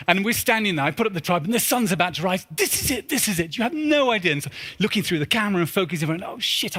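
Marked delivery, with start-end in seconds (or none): 2.5-4.3 high, breathless excitement; thrilled. Retold or imitated. Sun rising at last. 6.2-6.8 whispered, high oh shit; sudden breathy dismay; dismayed curse. Retold or imitated. Realizing the wrong lens.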